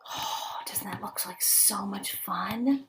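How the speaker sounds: slow awed whisper. Acted. Teacher whispers to children about vine swinging, slow and awed. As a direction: breathe it out softly, full of wonder.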